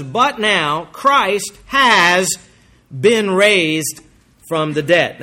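deliberate, slow, pulpit proclamation; slow emphatic scripture reading. Acted. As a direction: proclaim it slowly, letting each word land.

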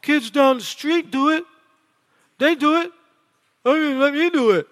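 high, put-on child's whine; hammed-up kid begging to be allowed; pleading kid. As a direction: plead with dad in a little kid's voice. Voices child pleading with dad.

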